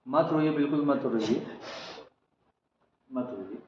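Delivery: very soft, low soothing hush; quiet her down gently, fading out; hushing her tears. Real, not acted. Baba hushing the sobbing caller, soft and tender. Consoling a crying woman.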